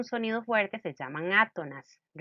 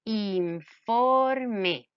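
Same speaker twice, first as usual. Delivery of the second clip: informe stretched into in, for, me; syllable by syllable; 3 dB louder than usual. Shown as a demonstration. Teacher breaks down informe for the class, syllable by syllable. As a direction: say "informe" slowly, sounding out each syllable. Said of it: "Veamos la palabra informe".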